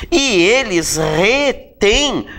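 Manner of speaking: very slow, syllables stretched; slow conjugation, word by word; drawn-out dictation. Shown as a demonstration. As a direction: stretch each word as if writing it out. Writing out the conjugation on board.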